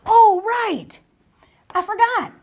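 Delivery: high-pitched pupil's sudden realization; pupil suddenly remembering. Acted. Teacher playing a pupil who forgot the rule, sudden realization.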